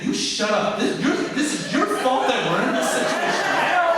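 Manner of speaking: high, loud laughing rebuke; shrill mock tirade; mock angry retort. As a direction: yell at your own brain, half-laughing. Snapping at his own brain.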